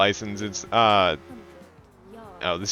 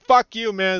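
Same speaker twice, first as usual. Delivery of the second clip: higher, cocky imitated retort; mock defiant; 10 semitones higher than usual. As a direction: fire back like a smart-mouthed kid. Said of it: "It's like".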